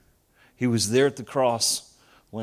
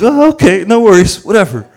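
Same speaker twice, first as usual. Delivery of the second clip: much higher and louder, hammed-up carefree; loud mock-breezy dad; 8 semitones higher, 15 dB louder than usual. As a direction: shrug it off way too loudly. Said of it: "I'm like".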